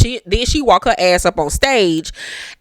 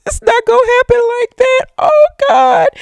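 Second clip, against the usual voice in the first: much higher, louder, fast mocking squeal; 4 dB louder than usual. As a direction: squeal it like you're mocking his dream. Mocking Fez's hopes.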